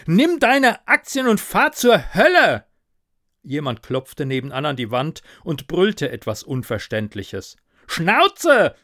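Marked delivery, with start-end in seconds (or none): 0.1-2.6 high, loud, furious yell; angry scream. Acted. Narrator yells at nagging ghost for peace, angry scream. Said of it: "schrie ich". 7.9-8.7 loud, high, furious shout; angry yell back. Acted. Narrator shouts back at neighbour pounding wall, angry yell. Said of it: "schrie ich zurück".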